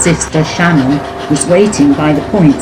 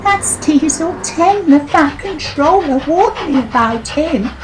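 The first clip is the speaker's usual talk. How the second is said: put-on young engine voice; 13 semitones higher than usual. Voicing Millie.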